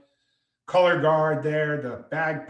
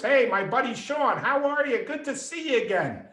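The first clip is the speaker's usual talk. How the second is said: high-pitched, delighted hello to a buddy; imitated hearty greeting; 8 semitones higher than usual. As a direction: greet an old pal with lifted, exuberant pitch. Senator greets his young friend heartily.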